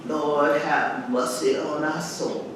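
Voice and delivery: hushed, low prayer inside the poem; quiet grace. Acted. Mother saying grace in the poem, low and hushed. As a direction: drop into reverent grace. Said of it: "recite the words to this poem".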